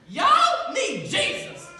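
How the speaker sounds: slow, drawn-out and loud, a laugh inside; drawled proclamation. Pretend. Youth speaker voicing Jesus, slow loud drawl. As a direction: stretch out Y'all need Jesus like a preacher. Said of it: "Jesus said".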